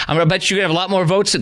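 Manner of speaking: light Wisconsin accent imitation. Shown as a demonstration. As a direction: mimic a Wisconsin local, rushing. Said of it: "that's how they talk".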